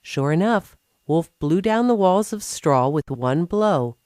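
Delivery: usual speaking voice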